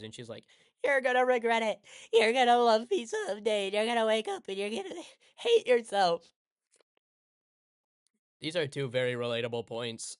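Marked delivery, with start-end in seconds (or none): 0.8-6.3 much higher, louder hammed-up wail; screaming and crying. Hina screaming at Taiki after being rejected, mock wail. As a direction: shriek it like a spurned girl. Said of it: "screams and cries".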